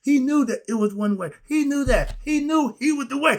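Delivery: rising to a fervent pitch; excited. Real, not acted. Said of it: "I'm working up a steam today".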